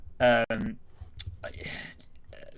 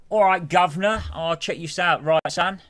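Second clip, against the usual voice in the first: loud, higher-pitched cockney caricature; 7 semitones higher, 7 dB louder than usual. Acted. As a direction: ham up a London geezer accent. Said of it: "how people stereotypically think of English people talking".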